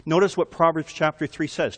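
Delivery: ordinary talk, nothing marked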